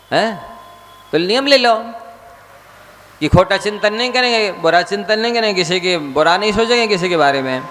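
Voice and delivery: high, insistent sermon pleading; a monk exhorting his listeners; fervent preaching. Acted. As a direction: press them to take the vow. Exhorting listeners to take vow.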